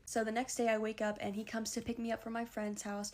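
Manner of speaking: ordinary talk, nothing marked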